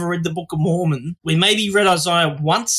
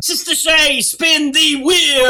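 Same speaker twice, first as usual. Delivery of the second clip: higher, stretched-out host call; game-show call; 6 semitones higher, 3 dB louder, much slower than usual. Pretend. Host calls Sister Shay to pick an accent, mock game-show call.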